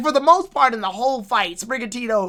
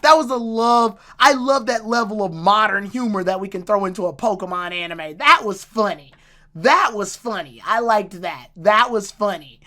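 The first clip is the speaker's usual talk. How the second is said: usual speaking voice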